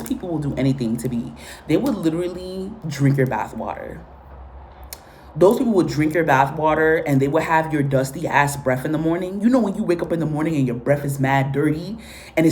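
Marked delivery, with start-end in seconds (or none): none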